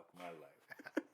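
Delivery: low, slow, softly muttered aside; quiet, resigned aside; muttered dismay. Retold or imitated. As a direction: mutter it to yourself, defeated. Recalling own dismayed inner thought.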